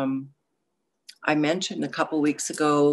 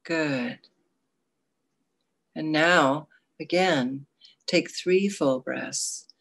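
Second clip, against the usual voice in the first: guided meditation. Acted.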